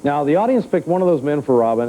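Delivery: ordinary talk, nothing marked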